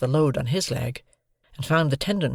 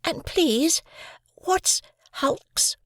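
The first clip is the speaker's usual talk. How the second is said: put-on much higher, pleading boy; young boy Pip; much slower than usual. A narrator's reading. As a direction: ask sweetly, a small boy. Pip asks.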